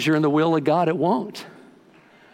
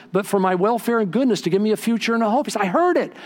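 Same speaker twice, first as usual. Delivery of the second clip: high, urgent pulpit storytelling; fervent preaching testimony; 8 semitones higher than usual. Retold or imitated. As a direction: testify with mounting conviction. Retells announcing God's message.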